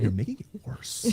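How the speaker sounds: slow, strained comic complaint; mock pained protest. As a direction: drag the complaint out in mock agony. Acting out his pained protest to wife.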